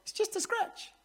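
high pitched mock knight voice; Monty Python quote. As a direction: squeak it out like a silly knight. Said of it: "that scene where they start cutting".

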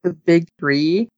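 ordinary delivery

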